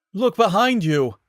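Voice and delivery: loud, high call over a speaker; intercom shout. Acted. Station guard shouting at Samus through the intercom. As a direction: yell the warning down from above.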